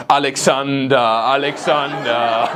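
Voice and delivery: put-on slow sung German-accented Alexander. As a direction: croon it in a thick German accent. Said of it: "sang along in a German accent".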